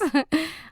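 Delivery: short high giggle. Acted. Nicola stretching for the ticket, giggle. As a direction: laugh at yourself. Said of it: "lachte Nicola".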